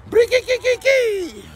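high trill. Acted.

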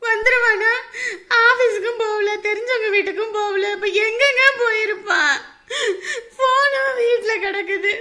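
loud tearful despair. Acted. Mother cries to her husband that the son went nowhere known, despairing. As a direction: cry out your fear, voice breaking.

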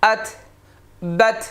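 আত, বাত ending in a t with a little air; jazm on ta. Shown as a demonstration. Teacher demonstrating ta with jazm, airy final t. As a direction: close আত, বাত on a lightly puffed t. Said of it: "তাহলে উচ্চারণটা হবে".

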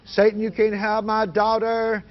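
high, drawn-out rebuke of the devil; retold yell. Father on the porch warning Satan off his daughter, high retold yell. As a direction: yell it slowly, re-enacting the defiance. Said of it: "I began to yell at him".

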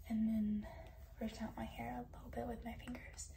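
soft late-night whisper. Real, not acted. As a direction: whisper so no one wakes. Bedtime routine, whispering quietly.

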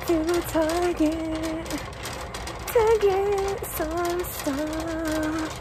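muffled, slow, through a mask; inside a rubber mask. Real, not acted. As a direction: talk slowly behind a rubber mask. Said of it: "it's hard to breathe in this mask".

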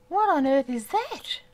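high, loud astonished cry. Real, not acted. Player spotting something strange, astonished. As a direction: exclaim it in real amazement. Startled by unknown sight.

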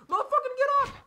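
high, loud desperate yell; frantic yell. Real, not acted. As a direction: scream at him to get up. Urging downed teammate up.

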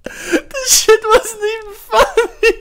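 high, slow, words through laughter; can barely speak for laughing. Real, not acted. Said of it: "I can't stop laughing".

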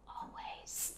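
"Always" whispered, breath only. Shown as a demonstration. Teacher whispers "Always" as an echo for learners. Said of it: "nhớ phát âm cuối giùm cô nha".

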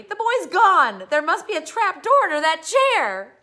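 full-volume, high-pitched alarm; hysterical yell. Acted. Father tells his wife the boy vanished, hysterical yelling. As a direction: shout it in mounting panic. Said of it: "he yelled at Missus Carmody".

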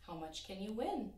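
usual speaking voice

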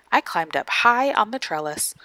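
ordinary delivery

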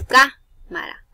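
"cámara" with CÁ loud and stretched; stress on first syllable. Shown as a demonstration. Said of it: "Lleva tilde en la tercera".

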